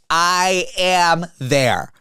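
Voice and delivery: each word stretched out very slowly; emphatic eagerness. Retold or imitated. Telling friends how keen the reply was, drawn-out and emphatic. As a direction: drag out every word for emphasis. Eager answer to hangout invite.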